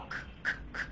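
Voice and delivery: normal talking voice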